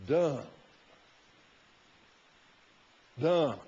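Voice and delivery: a single blunt "Dumb." dropped after a pause. Acted. Preacher calls Haman foolish with a blunt, separate "Dumb.". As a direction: let "Dumb." land short and heavy. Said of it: "should be Dumb".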